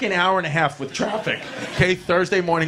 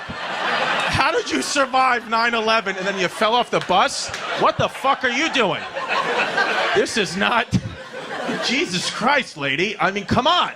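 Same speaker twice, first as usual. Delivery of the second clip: exasperated rant; 6 semitones higher than usual. Retold or imitated. Ranting at mom about bus.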